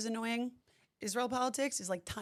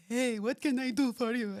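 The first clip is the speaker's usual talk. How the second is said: put-on slow Israeli accent; 3 semitones higher, 3 dB louder than usual. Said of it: "I start sounding a little like".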